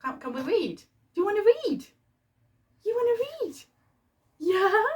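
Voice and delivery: put-on high, eager child voice begging to read; squeaky toddler asking mummy for a story; voicing her little son. Mum voicing her baby son begging for a book, high and eager. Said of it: "He's looking at all these books like".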